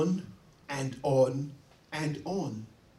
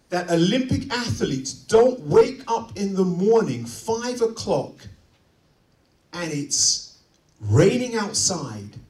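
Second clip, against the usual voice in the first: usual speaking voice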